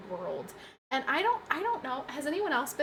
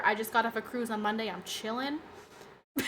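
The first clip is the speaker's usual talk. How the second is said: ordinary delivery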